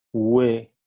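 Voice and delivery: Ue glides upward in pitch, u to e; rising tone demo. Shown as a demonstration. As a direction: let Ue rise from the u into the e. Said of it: "el tono de voz va aumentando".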